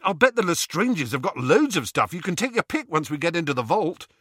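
high-pitched, eager rush. A narrator's reading. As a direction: pitch the offer excitedly, high and quick. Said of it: "said Ron eagerly".

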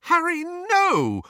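high, drawn-out plea; begging. A narrator's reading. Hermione trying to stop Harry, begging in a high cry. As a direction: beg him desperately to stop. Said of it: "Hermione begged him".

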